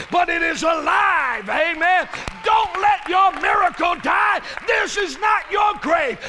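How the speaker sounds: high, loud shouted climax; full-force preaching. Acted. Preacher urges the congregation not to give up, shouting. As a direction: drive it home to the crowd.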